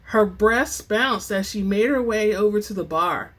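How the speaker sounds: ordinary delivery, nothing marked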